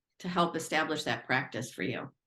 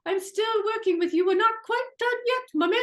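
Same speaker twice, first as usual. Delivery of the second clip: much higher British voice for the pet; British accent; 13 semitones higher, 4 dB louder than usual. Retold or imitated. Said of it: "a British voice".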